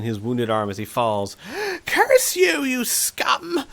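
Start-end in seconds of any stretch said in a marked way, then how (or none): 1.8-3.6 high, shrill furious shriek. Acted. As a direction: scream the curse in pain. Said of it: "he shrieks".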